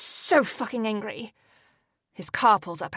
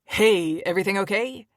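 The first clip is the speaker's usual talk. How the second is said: cheerful; 3 dB louder than usual. A narrator's reading. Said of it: "he asks cheerfully".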